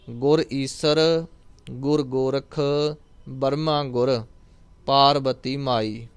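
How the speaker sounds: ਗੁਰ ਈਸਰ, ਗੁਰ ਗੋਰਖ, ਬਰਮਾ ਗੁਰ, ਪਾਰਬਤੀ ਮਾਈ in pairs with a pause after each. Shown as a demonstration. Teacher showing the sangat where to pause, in word pairs. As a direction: read two words, pause, two words, pause. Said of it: "ਦੋ ਦੋ ਸ਼ਬਦਾਂ ਦੇ ਜੋੜੇ".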